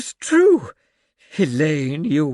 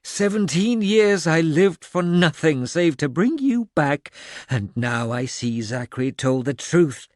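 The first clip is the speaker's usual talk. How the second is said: hateful tirade; 7 semitones higher, 3 dB louder than usual. A narrator's reading. The old man rages at the ghost of the woman he hates, loud and bitter. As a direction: spit out seventeen years of hatred.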